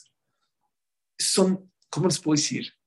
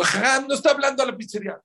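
put-on high, fast childlike objection; acted protest; 12 semitones higher, 4 dB louder, faster than usual. Pupils correcting the rabbi, high fast protest. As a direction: blurt it out high and quick.

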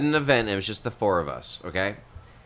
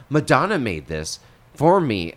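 slow, higher, louder boast; proud child; 6 semitones higher, 8 dB louder than usual. Retold or imitated. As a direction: brag like a kid at school. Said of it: "And I said".

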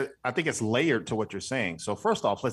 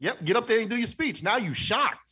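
high, excited urging; 10 semitones higher than usual. Retold or imitated. Podcaster pictures a friend winning, high and excited. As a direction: push him up to the stage.

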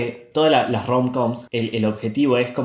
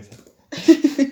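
squeaky voice; 5 dB louder than usual. Acted. Jokey squeal mid-sentence.

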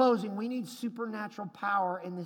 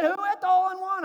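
loud, high, fast calling out; excited shout; 11 semitones higher, 10 dB louder, faster than usual. Retold or imitated. Worker shouting to the golfers about the hole in one. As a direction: shout it out in amazement. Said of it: "He said".

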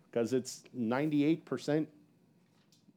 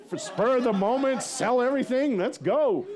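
high, loud imitation of eager co-pastor; gung-ho enthusiasm; 8 semitones higher, 4 dB louder than usual. Co-pastor loving the adventure, gung-ho mock excitement. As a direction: cheer it on impulsively. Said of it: "She loves this stuff".

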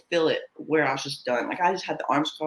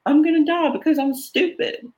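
much higher and louder, panicked; panicked realization; 11 semitones higher, 5 dB louder than usual. Retold or imitated. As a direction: blurt it out as the terror hits. Reliving believing she would die.

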